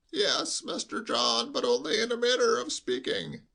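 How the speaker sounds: high, slow, quavering bleat; old servant bleating his excuse; bleating old butler. Acted. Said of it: "bleated like a sheep".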